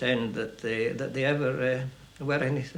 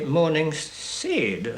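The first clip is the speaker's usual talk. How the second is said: drawn-out mock Morningside "Sade"; slow send-up of an Edinburgh Morningside accent; much slower than usual. Shown as a demonstration. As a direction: imitate Morningside, narrowing and stretching the vowel. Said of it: "in a mocking frame of mind".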